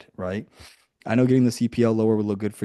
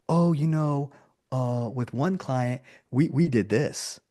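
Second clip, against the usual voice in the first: higher, slow, unsure fumble as if making it up; hesitant fumbling expert; 4 semitones higher, much slower than usual. Pretend. Consultant bluffing a med spa owner, slow and unsure. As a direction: drag out the words like you're bluffing. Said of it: "there's two scenarios".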